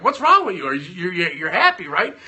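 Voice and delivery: high, loud incredulous "what's wrong with you"; mock-bewildered husband; baffled outburst. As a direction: sound bewildered by her tears. Retelling wife's crying over earnings.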